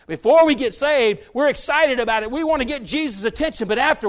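loud, high preaching build; preaching at full force. Acted. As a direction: drive the point home from the pulpit.

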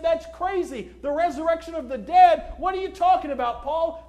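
loud, high mock disbelief. Crowd scoffing at Paul's resurrection message, imitated loud mockery. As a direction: scoff loudly, as an imitated heckler. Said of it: "Some mocked. They looked and they said".

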